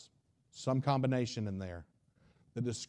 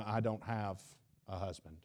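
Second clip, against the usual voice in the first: much softer, lower, almost swallowed; ashamed murmur; 4 semitones lower, 9 dB quieter than usual. Retold or imitated. Woman exposed by Jesus, ashamed and very quiet. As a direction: shrink away as you say it. Woman caught, tries to flee.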